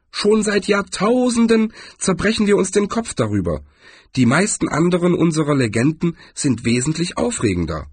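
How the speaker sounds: hohe, fremde Trollstimme; troll. Acted. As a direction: ein kleiner, gelassener Troll. Troll voice in audiobook. (hohe Trollstimme)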